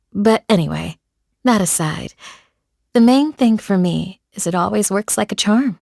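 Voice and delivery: normal talking voice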